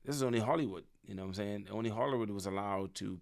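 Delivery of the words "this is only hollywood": ordinary talk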